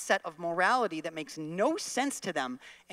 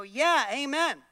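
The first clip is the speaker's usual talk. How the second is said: congregation agreeing; 7 semitones higher, 4 dB louder, slower than usual. Pretend. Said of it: "we go".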